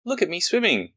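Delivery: high, proud squeaky little Roo. Acted. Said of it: "squeaked Roo".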